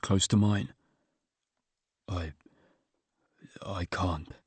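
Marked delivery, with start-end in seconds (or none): 2.1-4.3 choked, barely audible; faltering tender eulogy. A narrator's reading. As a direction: voice breaking with grief. Grieving son can't go on.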